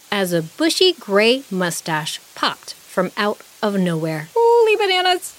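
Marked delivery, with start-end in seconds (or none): 4.3-5.3 high, loud startled squeal; shriek. A narrator's reading. Dragonfly startled by a popping mustache, shrieking. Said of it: "and shrieked".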